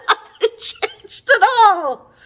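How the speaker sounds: high, slow laughing; amused high-pitched laughing complaint. Real, not acted. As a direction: laugh through the words in disbelief. Laughing at the book.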